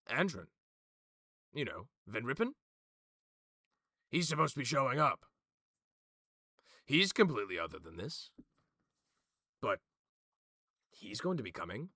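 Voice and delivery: insistent objector, pushing the point; Magin. Acted. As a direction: press them, emphatic and lively. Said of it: "You watch as Magin goes".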